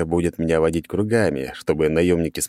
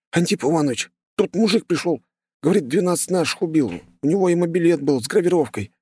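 breathless, high, hurried report; urgent panting report; 11 semitones higher than usual. A narrator's reading. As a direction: blurt it out gasping for air. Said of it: "задыхаясь, выпалил пацан".

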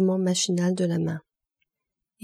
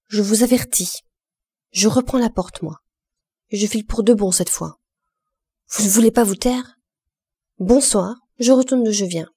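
louder, raised angry warning; furious threat; 2 semitones higher, 4 dB louder than usual. A narrator's reading. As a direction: threaten to walk out for good. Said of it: "s'écria Lantier furieux".

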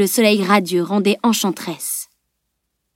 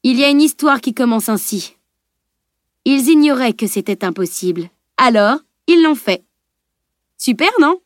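playful storytelling with a higher, triumphant finish; gently ironic; 4 semitones higher than usual. A narrator's reading. Mathieu teases the analyst with a saying, gently ironic. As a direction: tease cheekily, lifting on the punchline. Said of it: "gentiment ironique".

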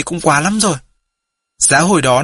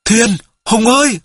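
higher, drawn-out call to a friend; boy calls to Hùng as a sailboat nears, higher and drawn out; crying out; 6 semitones higher than usual. A narrator's reading. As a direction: call out slowly across the water. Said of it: "Nam kêu lên".

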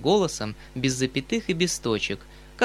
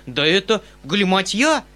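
louder, higher, uneasy cry; worried exclaim; 6 semitones higher, 6 dB louder than usual. A narrator's reading. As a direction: cry out, troubled. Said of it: "вскричал дядя с беспокойством". (встревоженный возглас)

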